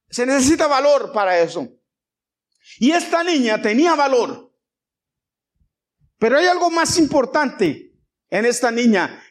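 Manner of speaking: high, declarative sermon delivery; emphatic preaching. Real, not acted. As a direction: drive the point home to the congregation. Preaches to congregation.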